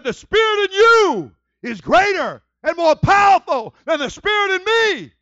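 slow, high, very loud possessed cry; screamed wail. Retold or imitated. Fortune teller's devil yielding to a preacher, screamed wail. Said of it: "she started wailing. She started screaming".

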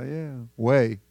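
usual speaking voice

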